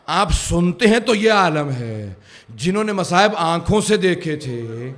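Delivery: impassioned preaching. Real, not acted. Preacher tells listeners of those who saw the suffering, impassioned. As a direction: press the grief on the listeners.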